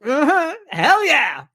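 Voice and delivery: high-pitched mock agreement; hammed-up high cheer of approval; imitated hyped audience. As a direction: mimic an over-keen American crowd member. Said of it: "kind of got on my nerves".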